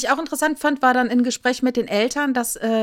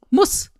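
Muss, jumped high and louder; emphatic must; 9 semitones higher, 4 dB louder than usual. Real, not acted. Insisting the selection must happen, emphatic. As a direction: hit Muss hard as a final point. Emphatic one-word repeat.

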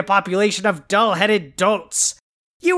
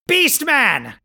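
calling out; 6 semitones higher than usual. Acted. Calling out for henchman.

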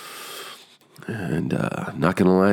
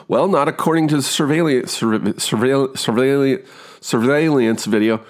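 fixated stammer; 9 semitones higher than usual. Retold or imitated. Actor stumbling on the word while the room watches. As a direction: stutter on the word, then push through. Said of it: "fucking focused on the word every single time".

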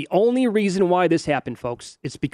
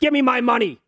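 high-pitched demand, falling at the end; hammed-up outraged yell; mock angry bettor; 7 semitones higher than usual. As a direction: demand it like a cheated gambler. Voicing bettor demanding winnings.

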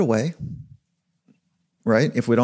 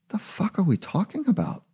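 put-on kid's inner voice; 8 semitones higher, 5 dB quieter than usual. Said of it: "I say to myself as a kid".

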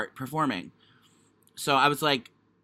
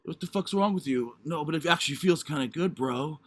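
lightly put-on straight bro; hint of Ninja Turtle dude; straight-guy voice. Giving in during the role play, lightly put-on straight-guy voice. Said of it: "why do you sound like a Ninja Turtle".

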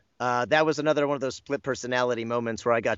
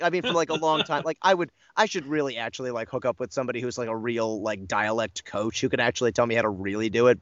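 ordinary talk

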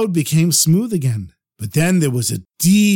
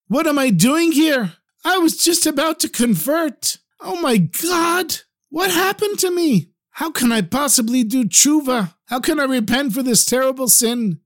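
panicked; 9 semitones higher than usual. Acted. Rabbi realizes he nearly converted.

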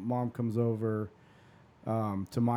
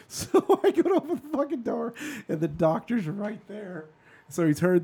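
very high, laugh-filled telling; 10 semitones higher, 4 dB louder than usual. Real, not acted. Telling how the doctor was right outside, laughing. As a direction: squeal the punchline through real laughter.

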